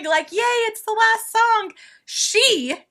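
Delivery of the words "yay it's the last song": high, retold giddy cheer; excited cheer. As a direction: squeal it like an excited fan filming. Acting out her excited videoing.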